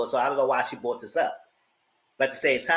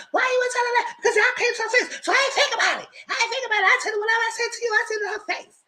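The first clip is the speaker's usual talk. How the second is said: much higher imitated shouting; hammed-up high squeaky friend arguing; high mocking argument voice; much faster than usual. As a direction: rattle it off high and fast. Imitating Chrissy's heated reply.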